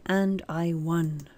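ordinary talk

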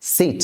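"সিট" with a short i; short vowel. Shown as a demonstration. Teacher showing learners no lengthening, short "সিট". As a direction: say "সিট" without drawing out the vowel. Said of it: "এখানে কোনো টান দেওয়া যাবে না".

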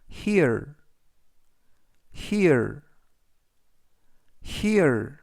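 "here" said clearly as /hir/ with a falling tune, each take after a pause; teacher modelling "here" for students to repeat; careful "here" with a clear r, falling; model pronunciation. Shown as a demonstration. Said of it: "이라고 발음하시면 되겠고요".

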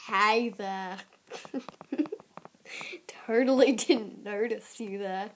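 stagey fake surprise at the viewer; bad acting. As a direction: act like you just noticed them, badly. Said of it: "I'm a terrible actor".

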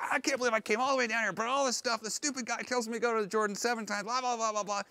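a fast, high-pitched mock rant; huffy rant. Naaman storming off from the prophet, ranting fast and high. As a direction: rattle it off like an insulted commander storming away. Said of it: "he storms off".

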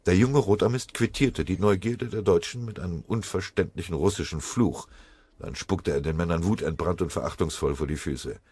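usual speaking voice

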